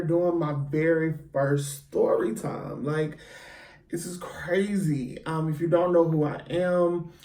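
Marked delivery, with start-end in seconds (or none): none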